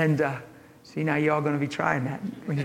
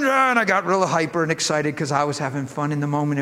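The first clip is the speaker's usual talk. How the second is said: usual speaking voice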